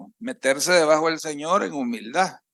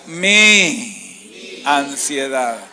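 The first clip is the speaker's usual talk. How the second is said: slow call to repeat; 5 dB louder, much slower than usual. Shown as a demonstration.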